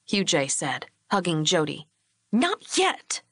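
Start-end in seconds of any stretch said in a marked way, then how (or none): 2.3-3.2 high-pitched angry refusal; furious. A narrator's reading. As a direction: refuse crossly, pitch jumping up. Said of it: "Lori's tone was still furious".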